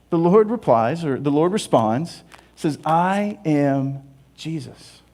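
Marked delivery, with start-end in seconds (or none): 2.9-5.0 solemn slow answer. Acted. The Lord answers Saul.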